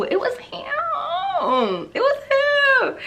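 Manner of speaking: high, very slow, drawn-out stress on him; emphatic reveal. Real, not acted. Telling viewers it was God all along, slow and high with awe. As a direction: let him ring out with awe. Testimony climax about God.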